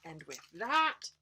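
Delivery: plain reading voice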